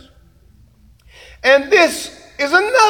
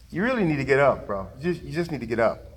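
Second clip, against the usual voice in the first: softer, lower, matter-of-fact voice of God; God's calm reply; 8 semitones lower, 9 dB quieter, faster than usual. Retold or imitated. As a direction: tell him gently but firmly to get up. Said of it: "And he's like".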